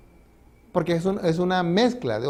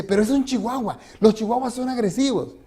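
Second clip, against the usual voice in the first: worried big guy; 6 semitones higher than usual. Retold or imitated.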